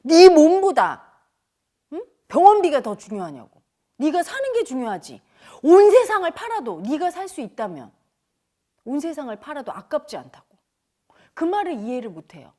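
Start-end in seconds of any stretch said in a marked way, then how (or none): 0.0-10.6 scolding. Retold or imitated. Teacher scolding a patient who worries about hospital bills, loud and stern. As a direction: tell her off hard, hammering each phrase. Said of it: "그래서 제가 혼내줬지".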